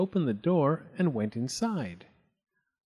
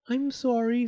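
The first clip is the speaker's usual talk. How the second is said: higher, mildly rueful apology; sad Goldilocks; 10 semitones higher than usual. A narrator's reading. Goldilocks apologising to the bears, mildly sad. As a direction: apologise in a small high voice. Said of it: "said Goldilocks sadly".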